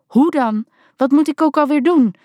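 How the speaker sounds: put-on high, panicked girl's voice; flustered and losing it; a ten-year-old panicking behind the wheel; panicky. A narrator's reading. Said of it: "klonk het paniekerig".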